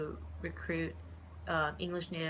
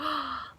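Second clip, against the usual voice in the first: mock gasp; 8 semitones higher than usual. Vendor delighted by her.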